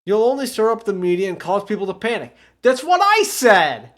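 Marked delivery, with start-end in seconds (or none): 2.6-3.9 higher, loud, slow I-told-you; indignant shout. Acted. Chief backing himself up in front of Aya, indignant shout. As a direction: bark it like you were right all along.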